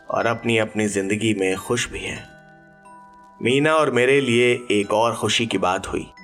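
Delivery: ordinary delivery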